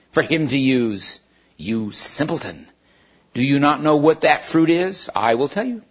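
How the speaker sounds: plain reading voice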